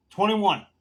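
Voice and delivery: loud, high counter-offer in imitation; mimicked haggling. Customer haggling with a cigar seller, mimicked haggling.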